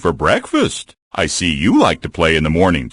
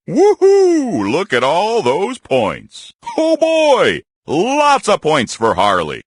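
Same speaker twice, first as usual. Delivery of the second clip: high whooping cheer; thrilled whoop; 12 semitones higher, slower than usual. Acted. Bear thrilled at his big score, whooping. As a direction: whoop at the pile of points.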